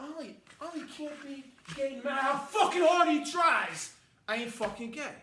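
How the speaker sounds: loud, high scoffing voice; loud mock outrage of a snob; mocking impression. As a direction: play a loud dismissive gossip. Mocking people reacting to fame.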